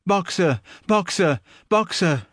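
high desperate call; crying out. A narrator's reading. Clover crying out after the van.

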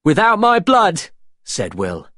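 high, loud, defiant declaration; defiant young hero. Acted. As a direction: proclaim it to the pirates with resolve. Will's defiant stand.

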